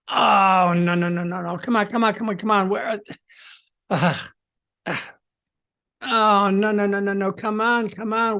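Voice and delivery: frantic muttered no and come on; panicked inner monologue. Shown as a demonstration. Coach acts out losing his phone, panicking. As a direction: fragments of rising panic. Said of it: "So here's what I do".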